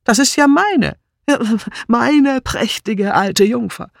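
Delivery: high, animated delight; excited exclamation. A narrator's reading. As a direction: burst out with delighted recognition. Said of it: "rief Sir Henry".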